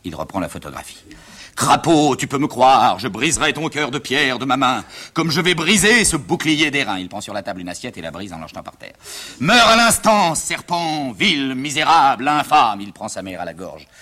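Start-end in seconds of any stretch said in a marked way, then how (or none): 1.6-7.0 theatrical vow of vengeance; stage tragedian in full rant; declaiming tragic hero. Acted. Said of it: "pour faire le rôle d'Hector". 9.4-12.8 loud, drawn-out death curse; grand theatrical fury; declaiming tragic hero. Acted. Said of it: "papa, je répète".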